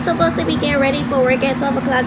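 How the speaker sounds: loud, fast, so the phone hears; talking loud. Real, not acted. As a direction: raise the voice over the noise. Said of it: "but I'ma talk loud".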